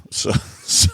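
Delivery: ordinary delivery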